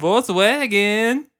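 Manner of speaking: slow, high mock German accent; drawn-out German sales pitch. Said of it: "You can't do that accent".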